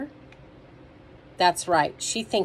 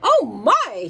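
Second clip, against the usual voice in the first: high, loud mock dismay; storybook fox's "oh dear" act; fox's show of concern; 5 semitones higher, 7 dB louder than usual. A narrator's reading. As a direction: exclaim with exaggerated concern. Fox feigns concern in story.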